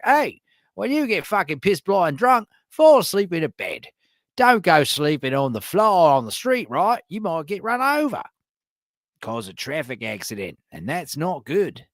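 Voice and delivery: hammed-up Australian public announcement; exaggerated Aussie accent. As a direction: lay the Aussie accent on thick. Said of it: "that's a great exaggerated Aussie accent".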